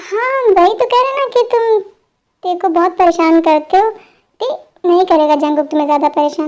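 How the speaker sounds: usual speaking voice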